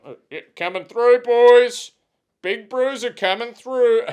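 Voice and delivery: high, loud mock-swaggering announcement; boisterous calling out. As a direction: holler your way through the pub crowd. Re-enacts his tipsy swagger, calling out.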